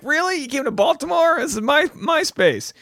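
high whiny mock indignation; lightly voiced offended guy; mock indignant. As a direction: squeak out the disbelief. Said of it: "He's like".

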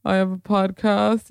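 fumbling for an answer. Retold or imitated. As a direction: act out being stuck for what to say. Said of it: "I have trouble answering that".

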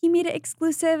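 imitating a girl glowing after her date; smiling big. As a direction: say it through a big smile, voice lifted. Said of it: "smiling so big".

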